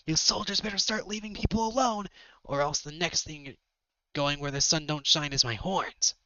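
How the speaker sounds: put-on high-pitched plucky young hero; Spyro. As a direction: challenge the soldiers in a high heroic voice. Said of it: "Spyro jumps in heroically".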